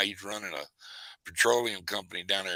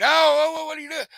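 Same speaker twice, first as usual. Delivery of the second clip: shrieking oh oh, much higher and louder; imitating a scream; 11 dB louder, faster than usual. Uncle retelling nephew hit by a BB, imitated shriek. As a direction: mimic a kid's panicked yelp after being hit. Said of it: "And he screamed".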